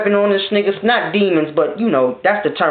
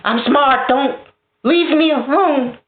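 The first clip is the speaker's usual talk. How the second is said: mock whine; 8 semitones higher, slower than usual. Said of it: "Like, oh my God".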